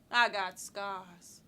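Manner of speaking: low, drawn-out statement; slow admission. Acted. Woman owning up to her scars, slow and low. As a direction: let the words out slowly and low. First take of repeated line.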